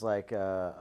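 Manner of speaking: ordinary talk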